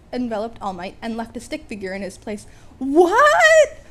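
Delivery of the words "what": high, loud stunned cry; awed confusion. A narrator's reading. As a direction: blurt it out in awe and confusion. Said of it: "in a mix of awe and confusion".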